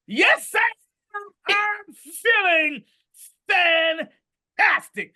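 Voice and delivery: high, loud, triumphant; ecstatic shout. Pretend. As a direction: proclaim it like a victory. Shouting answer to negative voice.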